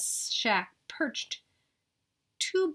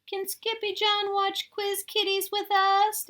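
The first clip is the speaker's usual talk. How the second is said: high, pleading little sister; little sister asking mama, high and sweet; little girl asking; 11 semitones higher, 4 dB louder than usual. Acted. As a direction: ask sweetly in a high kid voice. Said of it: "asked Jilly Bee".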